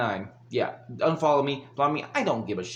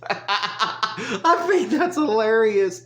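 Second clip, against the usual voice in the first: laughing; 7 semitones higher, 3 dB louder, much slower than usual. Real, not acted. Laughing at protest story.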